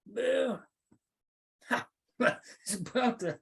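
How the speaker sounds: laughing while speaking. Real, not acted. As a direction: chuckle through the words. Laughs while starting a joke.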